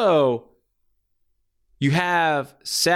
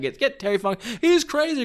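panicked crowd; 11 semitones higher than usual. Retold or imitated. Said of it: "Everyone's like".

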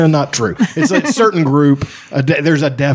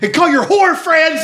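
mock angry sketch line. Sketch actor yelling at his partner before the slap. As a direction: bark it up high like the sketch character. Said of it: "He's like".

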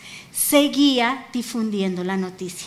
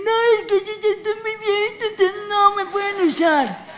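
high, put-on protesting voice; mock excuse; 8 semitones higher than usual. Preacher mimicking what the devil tells believers, high mock excuse. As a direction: rush into a high-pitched hammed-up excuse. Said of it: "el diablo te puede decir".

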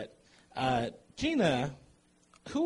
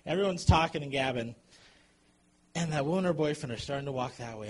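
ordinary talk, nothing marked

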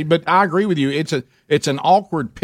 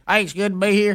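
higher, laughing country Southern take on a coach's greeting; Gary's country voice; 6 semitones higher, faster than usual. Retold or imitated. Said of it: "what if he would have been in Gary's voice?".

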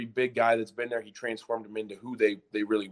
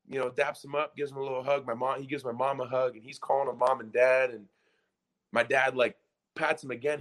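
ordinary delivery

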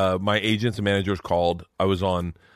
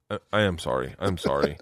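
low, subdued imitation of a wife relenting; contrite apology; 5 semitones lower, 3 dB quieter than usual. Husband voices wife coming into the bedroom, contrite. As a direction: climb down quietly into a halting sorry. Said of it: "so she will come down with it".

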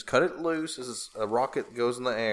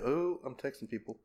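ordinary delivery

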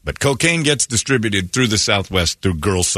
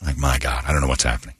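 usual speaking voice

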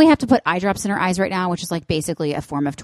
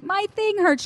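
put-on much higher, small child complaining; whiny kid voice; 13 semitones higher than usual. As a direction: pitch up like a hurt little boy.

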